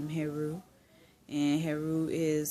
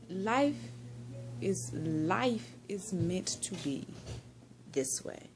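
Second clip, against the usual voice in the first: dreamy and slow; 3 semitones higher, slower than usual. Real, not acted. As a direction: let the words drift out slowly, almost whispered at the end. Savouring a life lesson.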